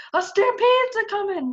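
high, loud urgent warning; alarmed hen. Acted. Hen warns the coyote of the stampede. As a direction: blurt the danger.

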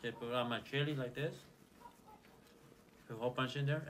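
ordinary talk

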